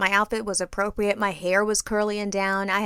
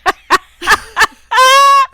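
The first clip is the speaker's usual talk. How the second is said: high, loud shrieking laugh; laughing so hard it's almost crying; helpless laughter; 13 dB louder than usual. Real, not acted. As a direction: crack up until it turns to tears. Hearing friend on speakerphone.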